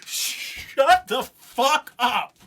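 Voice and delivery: slow, drawn-out, high, near-crying amazement; awed, stretched-out gasp of a line; stunned disbelief. Real, not acted. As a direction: let it out as if you can't believe your ears. Stunned by the rap verse.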